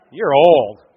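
loud, falling mock verdict; blunt verdict. People judging the speaker's age, loud blunt verdict. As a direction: drop it bluntly and loudly. Replaying the unwelcome response.

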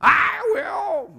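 very high, loud yowl; piercing cat-voice shout; meowing cat. A narrator's reading. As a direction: shriek it eagerly like a cartoon cat. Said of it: "meowed the cat".

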